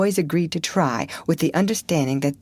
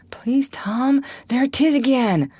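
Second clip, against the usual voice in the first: higher, pleading whisper; plead, trembling with fear; frightened whisper; 8 semitones higher than usual. Acted. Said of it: "in an agony of fright".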